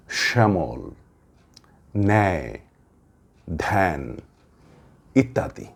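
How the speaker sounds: ব্যাকরণ খ্যাত শ্যামল each said apart with first syllable pressed; example words demo. Shown as a demonstration. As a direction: list the words slowly, clearly. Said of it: "আমি কিছু শব্দের কথা বলছি". (য-ফলার শব্দ স্পষ্ট উচ্চারণ)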